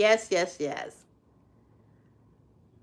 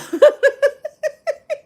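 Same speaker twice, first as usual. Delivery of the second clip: loud high laugh; 6 dB louder than usual. Real, not acted. Laughing at a viewer's comment, loud high laugh.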